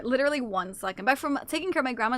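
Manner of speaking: ordinary delivery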